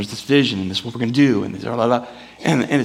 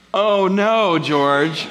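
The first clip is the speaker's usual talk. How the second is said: mock book refrain; 6 semitones higher, 3 dB louder, much slower than usual. Refrain after bad example.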